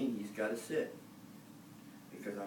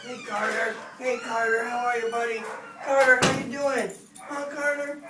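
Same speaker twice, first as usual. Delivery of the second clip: much higher and louder, gushing hello to the dog; excited dog greeting; 13 semitones higher, 10 dB louder than usual. Real, not acted. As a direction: greet the dog like you're thrilled to see him.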